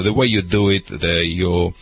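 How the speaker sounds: usual speaking voice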